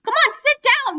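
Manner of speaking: high frantic yell. Real, not acted. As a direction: shout at them to sit. Yelling at game passengers.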